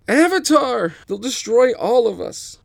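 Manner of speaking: loud, high panicked screaming; fleeing thugs screaming in terror; terrified scream. Acted.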